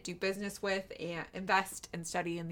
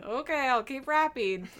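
higher, louder mock-weary giving in; exasperated compliance; 6 semitones higher, 6 dB louder than usual. Kate answering the person who snapped at her, exasperated. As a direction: say it with an audible eye-roll. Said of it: "rolled her eyes and was like".

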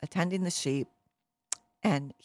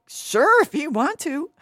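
high, loud, exaggerated go-ahead; doubtful king; 11 semitones higher, 9 dB louder than usual. King Saul letting small David fight, high and exaggerated. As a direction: toss out permission in a hammed-up voice.

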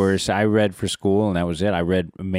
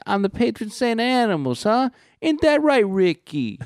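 very high, hammed-up Italian accent; hacky Italian accent; 14 semitones higher than usual. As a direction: imitate a kid's bad stage Italian. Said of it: "on this Italian accent that he's doing".

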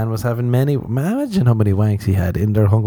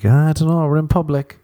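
higher-pitched, hesitant mock reluctance; 7 semitones higher than usual. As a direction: play a coy man declining in a raised voice. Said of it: "old [name] boy was like".

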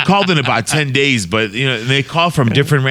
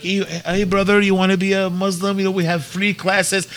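a light, higher take on the caller's pitch; imitating a telemarketer; 7 semitones higher than usual. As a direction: play the caller gently, a bit higher. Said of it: "they'd be like".

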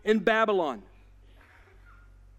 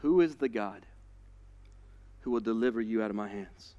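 low, soft menacing taunt; a lightly voiced tyrant's dare; menacing king; 9 semitones lower, 5 dB quieter than usual. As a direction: taunt them low and cold. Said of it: "he goes next level and he says".

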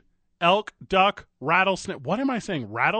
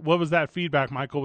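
ordinary delivery

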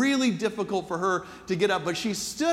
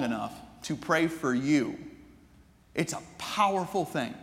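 hushed, heartfelt sermon ending; quiet sermon close; 4 semitones lower, 3 dB quieter than usual. Real, not acted. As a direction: let each line land gently before the congregation.

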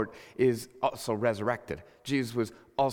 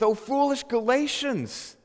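higher, slow exasperated scolding; intense rebuke; 10 semitones higher, 4 dB louder, slower than usual. Acted. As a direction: quote Paul's outburst with mounting intensity. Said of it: "keeps on getting more and more intense".

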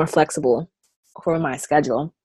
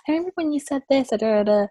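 higher, fast mock quote; quoting the girls; 8 semitones higher, 4 dB quieter, faster than usual. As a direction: rattle it off like a teen. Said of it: "they'll be like".

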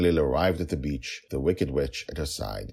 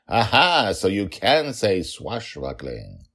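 triumphant gotcha; 5 semitones higher, 5 dB louder, slower than usual. Acted. Father catches daughter out.

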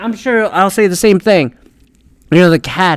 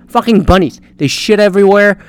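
usual speaking voice

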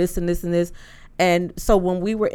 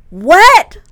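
high, loud disbelieving what; shocked what; 11 semitones higher, 13 dB louder than usual. Retold or imitated. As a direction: shriek it in disbelief. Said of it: "And I was like".

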